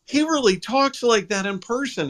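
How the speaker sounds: high-pitched retold amazement; amazed. Retelling his amazed reaction to the voice.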